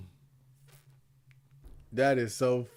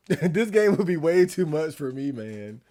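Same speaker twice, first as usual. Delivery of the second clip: usual speaking voice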